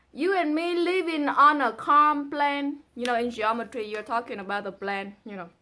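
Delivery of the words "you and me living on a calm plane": poem reading. Acted. Reads poem line.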